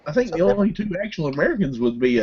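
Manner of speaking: usual speaking voice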